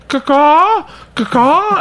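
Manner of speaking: high, loud repeated caws; high imitated crow squawks, loud. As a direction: call it out like a crow from downstairs. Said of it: "hear this guy going".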